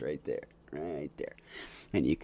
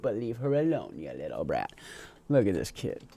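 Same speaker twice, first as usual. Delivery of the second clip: ordinary talk, nothing marked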